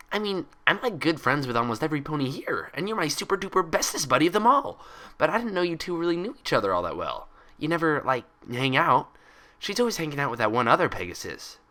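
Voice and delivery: put-on high, bouncy cheerful girl; bubbly Pinkie Pie voice. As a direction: chatter it out like an excitable pony.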